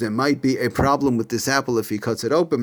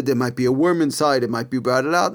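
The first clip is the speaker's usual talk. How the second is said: ordinary talk, nothing marked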